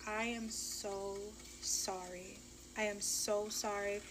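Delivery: soft, slow and small, sorry to the bone; meek apology. Retold or imitated. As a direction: hush it out like a guilty apology. Apologizing to baby daddy in car.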